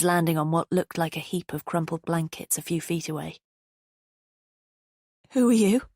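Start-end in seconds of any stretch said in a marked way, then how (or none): 5.3-5.8 high and loud, a frightened attempt at firmness; tremulous, trying to sound strong. A narrator's reading. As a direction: force it out strong but let it shake. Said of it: "it came out tremulous".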